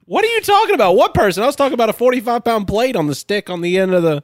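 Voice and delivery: high-pitched, baffled protest; real exasperated disbelief. Real, not acted.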